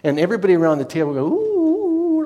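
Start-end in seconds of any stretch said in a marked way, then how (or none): 1.3-2.2 very high, drawn-out ooh; impressed ooh. Retold or imitated. Table guests reacting to the astrologer, very high ooh. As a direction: coo like an impressed crowd. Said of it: "like this".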